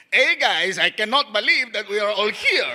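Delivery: put-on gardener with a Mexican accent; put-on Mexican-accented read, every word separate. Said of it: "play it like a gardener".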